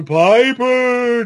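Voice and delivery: spooky ghost calling, slow and high. Retold or imitated. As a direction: stretch the name out like a haunting ghost. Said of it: "that's my ghost voice anyway".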